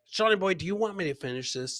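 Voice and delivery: normal talking voice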